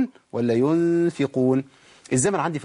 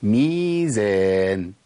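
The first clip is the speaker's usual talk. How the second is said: ميزان stretched very long on the first vowel; measured, drawn out; 4 semitones lower, 3 dB louder, much slower than usual. Shown as a demonstration. Teacher answering own question, long drawn-out word. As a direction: draw ميزان out slowly, holding the mee. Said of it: "كل حاجه ليها ايه؟".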